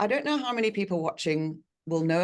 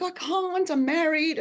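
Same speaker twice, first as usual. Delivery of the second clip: higher, half-joking protest of her own younger self; mock protest; 13 semitones higher, slower than usual. Retelling her reply to the Australian osteopath, higher mock protest. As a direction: squeak out the excuse like it's obvious.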